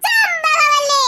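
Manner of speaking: high, drawn-out mocking call; teasing shout. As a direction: sing the nickname out to tease. Teasing name-call, acted scene. (கிண்டலாக நீட்டிக் கத்தி)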